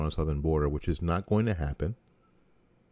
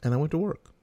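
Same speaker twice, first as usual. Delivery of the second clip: much higher, rising sing-song; mock cheery repeat; 9 semitones higher, faster than usual. Real, not acted. Telling listeners he shrugged off Obama's win, sing-song. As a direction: lift it up like it's no big deal.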